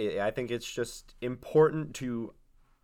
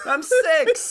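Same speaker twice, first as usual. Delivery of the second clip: put-on child voice; 11 dB louder than usual. Child answers grandma.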